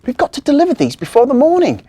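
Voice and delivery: high, loud, fast urgency; hurried elf. Acted. Marzi hurries to deliver before morning, fast and high. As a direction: rush it out.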